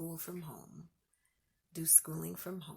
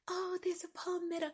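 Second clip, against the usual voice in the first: mock fancy voice; 12 semitones higher, 3 dB louder than usual. Mocking what people say.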